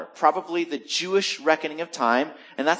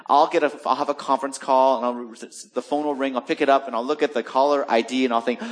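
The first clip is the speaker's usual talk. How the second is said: usual speaking voice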